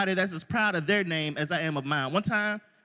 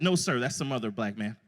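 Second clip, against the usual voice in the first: deadpan denial; 10 semitones lower, 4 dB quieter than usual. Retold or imitated. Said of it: "I said".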